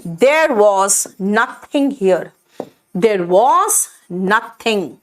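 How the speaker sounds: there was nothing here, very slow; slow model sentence. Shown as a demonstration. Teacher shows the English sentence, slowly. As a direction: say it slowly for students to copy. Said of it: "मैं क्या कहूंगी".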